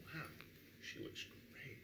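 awed whisper under the breath; hushed wow as she comes into view; breathless whisper. Retold or imitated. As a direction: whisper it, stunned by her looks. Awestruck at the singer's beauty.

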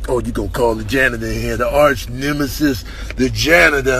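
usual speaking voice

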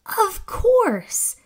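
a burst of discovery; excited realisation. Acted. Scout spotting the bread forest, excited eureka.

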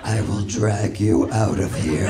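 very low demonic growl; satanic low voice. Acted. Mother threatening her child at Target, in a very low satanic voice. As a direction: drop into a satanic register and threaten. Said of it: "lower satanic register of my voice".

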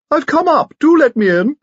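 loud falsetto greeting; extraordinarily high-pitched voice. A narrator's reading. Visitor calling through the door, extraordinarily high-pitched. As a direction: call out in a piping falsetto, very high. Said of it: "said an extraordinarily high-pitched voice".

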